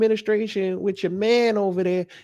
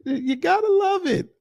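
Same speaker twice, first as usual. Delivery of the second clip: normal talking voice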